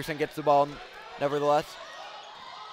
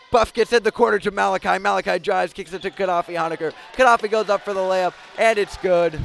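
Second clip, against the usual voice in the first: loud, building game call; excited play-by-play; 4 semitones higher, 6 dB louder than usual. Real, not acted. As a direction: ride the drive up to the basket. Layup call.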